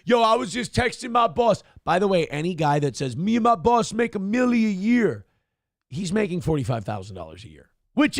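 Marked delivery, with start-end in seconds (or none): 0.0-1.6 higher mocking bro voice. Comedian mocking the passenger's boast, higher and scornful. 3.2-5.3 higher bragging bro voice; mock bro. Comedian mocking bros who brag, higher voice.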